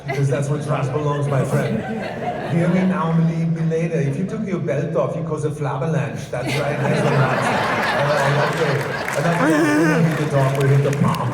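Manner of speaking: loud, berating Austrian gym-bro voice; defensive bodybuilder. Acted. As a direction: scold them like a pumped-up Austrian muscleman. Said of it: "they're so defensive".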